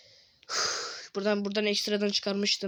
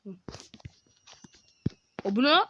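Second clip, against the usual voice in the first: normal talking voice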